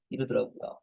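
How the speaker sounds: ordinary talk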